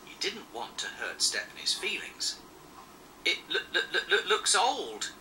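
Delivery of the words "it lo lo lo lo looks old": nervous stammer with a laugh. Acted. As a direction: stumble over the words awkwardly. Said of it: "he stammered".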